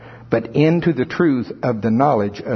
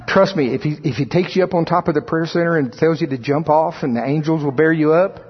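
ordinary talk, nothing marked

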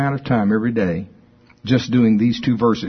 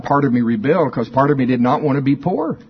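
ordinary talk, nothing marked

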